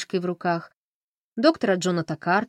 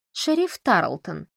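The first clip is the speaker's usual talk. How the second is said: slow, deliberate address of the title; formal demand; slower than usual. Acted. Camila presses the sheriff for press comments, slow and formal. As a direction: draw out the sheriff's title coolly. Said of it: "журналистка требует комментариев".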